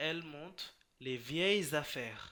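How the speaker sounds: vieilles affaires drawn out slowly, linked as "vieillezaffaires"; liaison demo. Shown as a demonstration. Teacher demonstrating the correct liaison reading to the class. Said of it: "এক্ষেত্রে পড়তে হবে".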